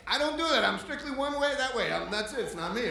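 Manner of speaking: loud, high comic denial; high-pitched defensive yelling; indignant protest. Retold or imitated. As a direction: shout your innocence, hammed up. Patient protesting doctor's suggestion.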